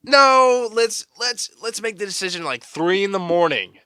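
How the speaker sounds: loud, high-pitched sarcastic cry; mock the absurd delay at high volume; mock outburst. Host ridiculing the coach's late decision, loud mock outburst.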